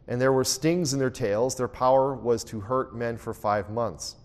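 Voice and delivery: a quiet, steady Bible reading; scripture reading. Acted. Teacher reads Revelation locusts verses to the class, calm reading. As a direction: read the verses evenly to the group.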